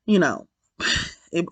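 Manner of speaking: trailing off, overcome with sadness; heartbroken fading. Real, not acted. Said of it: "it killed me".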